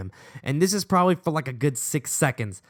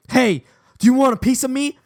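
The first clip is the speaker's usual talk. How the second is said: higher, louder confrontational call; mock challenge; 9 semitones higher, 5 dB louder than usual. Angry schoolboy challenging Sean, raised mock-tough voice. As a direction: square up, hammed-up tough talk.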